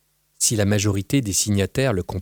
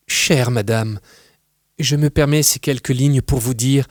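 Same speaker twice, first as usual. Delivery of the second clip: higher-pitched, insistent widower reading his letter; lonely widower pleading; 5 semitones higher, 5 dB louder than usual. Acted. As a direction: plead earnestly for an answer, pitched up. (veuf esseulé et suppliant, voix plus aiguë)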